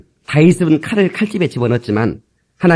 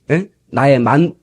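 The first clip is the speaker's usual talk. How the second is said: ordinary talk, nothing marked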